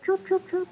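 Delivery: high birdy chirps; bird chirps. Shown as a demonstration. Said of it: "We have".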